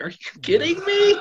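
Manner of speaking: high, loud retold outburst; loud, incredulous protest; outraged disbelief. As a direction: shoot up high in indignant disbelief. Retold outrage at being ratted out.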